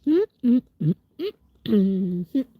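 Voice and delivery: humming through a mouthful; straining to swallow food; mouth full. Real, not acted. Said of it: "kinda hard to swallow".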